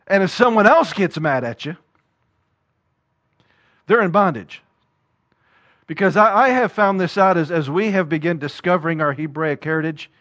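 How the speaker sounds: usual speaking voice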